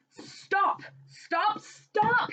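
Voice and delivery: high, rising cries; crying out. Acted. Husband cries out to silence his scolding wife, high pleading. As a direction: cry out to make her stop. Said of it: "he cried".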